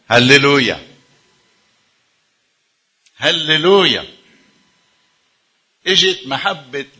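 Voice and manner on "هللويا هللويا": loud, slow rejoicing هللويا; joyful hallelujah. Real, not acted. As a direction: call it out in praise, stretched. Preacher exults, congregation cue.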